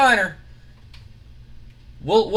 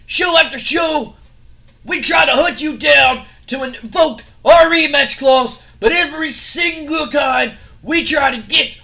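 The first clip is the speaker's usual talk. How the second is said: wrestling promo shout; 8 semitones higher, 6 dB louder than usual. Acted. Scott Steiner ranting at rivals in promo, loud high shouting. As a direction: bellow every word at the crowd.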